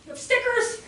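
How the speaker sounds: high, incredulous protest; imitated outraged child. Five-year-old offered stickers, imitated high incredulous protest. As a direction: climb high in mock disbelief. Boy's outraged thoughts voiced.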